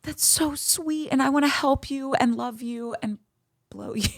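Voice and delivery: high, tender swoon sliding toward a laugh; mock gushing. Woman melting at a nervous man, gushing. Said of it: "I'm just like".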